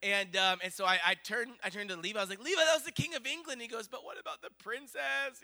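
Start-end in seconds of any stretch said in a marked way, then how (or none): 2.4-3.6 high, fast, louder exclamation; excited. Retold or imitated. Parent turns to child after royal sighting, excited. 3.9-5.4 put-on high-pitched small boy voice; upset child. Child upset at missing the princess, high disappointed voice. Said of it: "he's, like, so upset".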